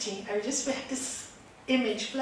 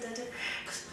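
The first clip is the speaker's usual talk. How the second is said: ordinary delivery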